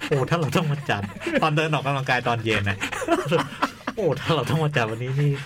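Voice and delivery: laughing through the words; giggling while talking. Real, not acted. Laughing over ruined new year.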